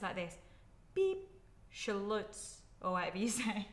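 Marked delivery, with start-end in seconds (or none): 0.9-1.3 high beep like a voice memo tone; mock beep. Vlogger imitating a voice memo tone, playful beep. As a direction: chirp a little beep. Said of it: "send voice memos".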